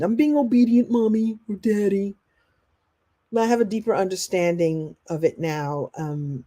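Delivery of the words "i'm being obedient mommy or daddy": bad little kid. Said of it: "sounds like you're a bad little kid".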